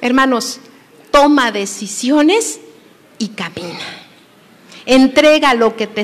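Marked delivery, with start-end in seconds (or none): 3.2-3.8 lower, soft finish; low and much softer; hushed. Acted. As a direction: let it fall to a hush.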